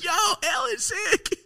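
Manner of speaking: very high and slow, dreamy fan voice; slow starstruck squeal. Retold or imitated. Retelling seeing a celebrity, high and slow. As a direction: stretch it out high like a dazzled fan. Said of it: "I'm like".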